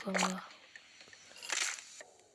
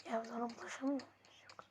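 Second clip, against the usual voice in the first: whisper; 13 dB quieter than usual. Real, not acted. Whisper aside while playing.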